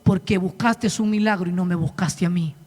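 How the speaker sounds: a hint of calm divine rebuke; lightly voiced tender answer; God's gentle correction. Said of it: "Y me dijo".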